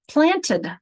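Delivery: planted said clearly as plan-ted; planted said slowly in two syllables. Shown as a demonstration. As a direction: give planted its two beats. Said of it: "an example of a two-syllable word".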